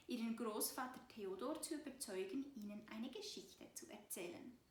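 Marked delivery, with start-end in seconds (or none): none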